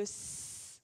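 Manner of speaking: "s" as a long voiceless hiss; isolated phoneme. Shown as a demonstration. Teacher showing the s gesture, "s" held as a hiss. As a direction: hold "s" as a pure sound, not the letter name. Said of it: "montrer la forme du".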